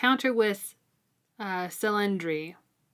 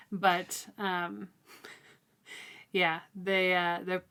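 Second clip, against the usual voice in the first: usual speaking voice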